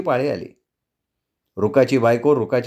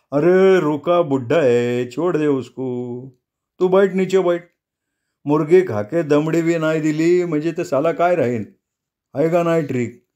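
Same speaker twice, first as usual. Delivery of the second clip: put-on drunk Nago; 3 semitones higher than usual. A narrator's reading. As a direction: soothe a friend while tipsy. Drunk Nago calms Benwa. (बेनवाला शांत करणारा दारू प्यालेला नागो, सावकाश बोलत)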